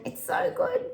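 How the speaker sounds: very high, gleeful; real joy, squealed; delighted squeal. Real, not acted. Delighted exclamation.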